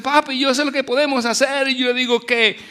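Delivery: put-on high, loud childlike excitement; excited child retold. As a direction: retell his excited idea with a laugh. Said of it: "pegó un grito y dice".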